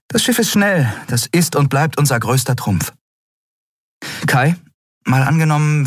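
rally the crew with quick resolve; eager young captain. A narrator's reading. Captain lays out the escape plan to Kai, quick and high.

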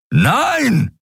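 high sharp protest; crying out. Acted. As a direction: blurt out a panicked no. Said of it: "rief Kero".

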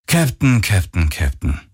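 descending, sighed repetition of the title; sighing reproach. A narrator's reading. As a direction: sigh it like a disappointed superior. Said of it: "ertönte es seufzend aus ihrem Komm".

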